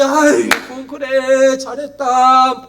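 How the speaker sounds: high, loud doting approval; hammed-up fond praise; effusive praise. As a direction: gush over them, drawing out the last word. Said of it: "막 칭찬해 주시면서".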